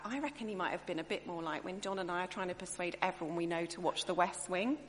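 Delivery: ordinary delivery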